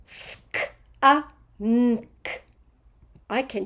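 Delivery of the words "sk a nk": Sk-a-nk broken into "s, k, uh, nn, k"; precise, spaced phonics sounds; careful sounding-out. Shown as a demonstration. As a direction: separate each sound of Sk-a-nk with short gaps. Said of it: "sound it out carefully".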